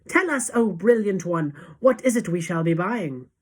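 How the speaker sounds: loud eager plea, dropping in pitch; crowd cry. A narrator's reading. Witches begging their leader to tell, eager cry. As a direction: beg loudly for the answer. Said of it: "they cried".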